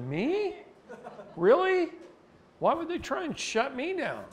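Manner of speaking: high, loud feigned astonishment; mock innocent surprise. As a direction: play dumb, wide-eyed and innocent. Mock innocent reply to attorneys.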